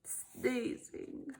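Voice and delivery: drained, whispered. Acted. Gerald whispering after a big sneeze. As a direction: breathe the word out weakly.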